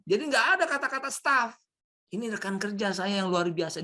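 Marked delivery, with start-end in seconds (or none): 2.1-3.8 lightly voiced proud boss praising; a hint of a boss showing off his staff; praising a colleague. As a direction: talk up your colleague with pride. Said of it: "dipuji terus".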